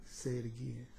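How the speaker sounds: low hushed purr; soft satisfied murmur; quiet, low and pleased; smooth serpent. Retold or imitated. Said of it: "that serpent was smooth".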